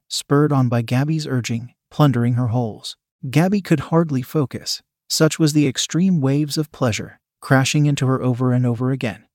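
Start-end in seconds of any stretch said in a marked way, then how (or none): none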